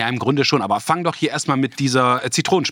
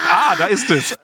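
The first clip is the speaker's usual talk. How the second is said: laughing shout; 9 semitones higher, 4 dB louder than usual. Acted. Watching Ole's face pucker.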